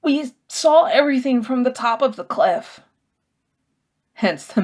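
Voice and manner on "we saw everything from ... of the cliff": distressed Sophos. Acted. Sophos explaining to narrator.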